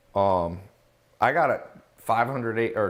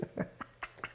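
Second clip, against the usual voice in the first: airy, voiceless laugh; breathy laugh; 19 dB quieter than usual. Real, not acted. Laughing at the comment.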